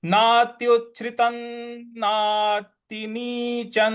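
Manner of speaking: नात्युच्छ्रितं ending in n, नातिनीचं drawn out; anusvara as n. Shown as a demonstration. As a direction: sound the anusvara as न. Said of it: "उसका न में उच्चारण ले आएंगे".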